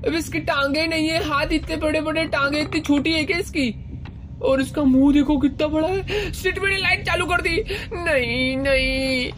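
high, panicked yelps at the monster; startled shrieks, voice jumping way up; real fright. Real, not acted. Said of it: "ये बहुत ज्यादा डर लग रहा है".

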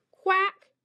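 loud, high quack; duck quack. Shown as a demonstration. Reading animal sounds aloud.

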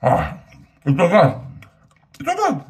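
chewing while speaking; muffled words through food; talking with mouth full. Real, not acted. Seen 1: Bites pizza at -2; jaw moves, lips closed between words throughout.

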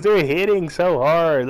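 ordinary talk, nothing marked

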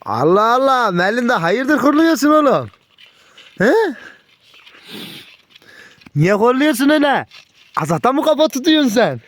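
high teasing pet talk; mock-scold the growling dog from way up; amused surprise. Real, not acted. (hırlayan köpeğe ince sesle şaşkın takılma)

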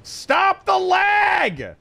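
very slow, high yell; fed-up shout. Real, not acted. Gamer yelling at the lagging game, drawn-out shout. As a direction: drag out the yell at the lag.

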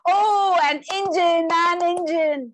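put-on high, loud, gleeful child repeating; excited little boy. Little cousin clapping and cheering, excited squeal. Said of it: "claps his hands with excitement".

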